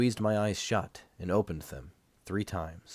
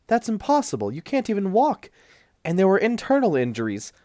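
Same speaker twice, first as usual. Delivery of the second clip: very high, loud, indignant nurse; nurse's voice shooting up in alarm; insisting the patient can't walk, high and loud; shocked nurse; 12 semitones higher, 7 dB louder than usual. A narrator's reading. Nurse protests patient leaving.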